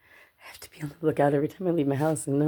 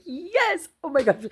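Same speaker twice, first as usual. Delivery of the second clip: giddy, pitched-up celebration; overjoyed; 13 semitones higher, slower than usual. Retold or imitated. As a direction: re-enact being so happy you squeal. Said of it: "I was so happy".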